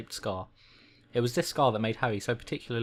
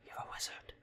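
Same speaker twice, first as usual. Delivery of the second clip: very soft whispered quote; whispered aside; 15 dB quieter than usual. Acted. Reader adding a quote after the reveal, whispered. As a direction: whisper it like a secret revelation.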